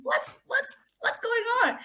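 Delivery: stunned what's-going-on; high, confused disbelief; baffled. Real, not acted. Reacts in disbelief to the story.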